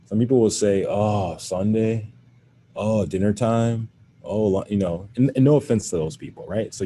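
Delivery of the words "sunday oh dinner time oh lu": ordinary talk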